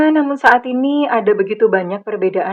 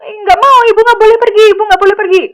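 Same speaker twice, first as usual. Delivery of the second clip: put-on much higher, louder whiny child wail; tantrum child; 12 semitones higher, 9 dB louder, faster than usual. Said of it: "minta ibu datang". (anak tantrum merengek memanggil ibu, tinggi dan keras)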